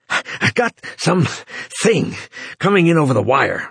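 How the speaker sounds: out of breath and halting; panting. Acted. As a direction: gasp the words out in pieces. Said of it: "Runciter panted, halting".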